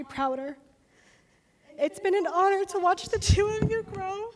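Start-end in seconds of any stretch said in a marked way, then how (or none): 1.8-4.3 fighting back tears through the line; choked up. Real, not acted. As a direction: voice breaking, higher, close to tears. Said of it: "I know, I couldn't do it".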